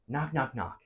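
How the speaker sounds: soft spoken door knock; imitated knocking. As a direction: say the knocks quietly, in rhythm. Acted door knock in story.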